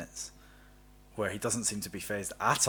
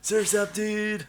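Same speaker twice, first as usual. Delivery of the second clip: surfer dude voice; 10 semitones higher, much slower than usual. Retold or imitated. Friends riffing on surfer voice.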